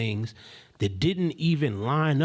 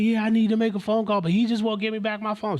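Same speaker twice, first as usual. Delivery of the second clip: high, raised, upset complaint; imitated hollering; 8 semitones higher, 4 dB louder than usual. Young woman asking to make a phone call, imitated hollering. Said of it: "So she hollering".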